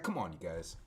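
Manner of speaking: usual speaking voice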